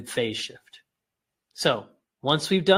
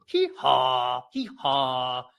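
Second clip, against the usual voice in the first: short HEE, long falling HAW; playful demonstrated donkey call; donkey bray; 2 semitones lower, much slower than usual. Shown as a demonstration. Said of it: "what a donkey sounds like".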